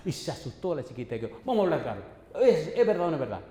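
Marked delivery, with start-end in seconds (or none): 1.4-3.4 louder, higher demand for the truth; stern confrontation. Retold or imitated. Pastor confronting a frightened student in counseling, stern and loud. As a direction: confront them, pressing loudly for an answer.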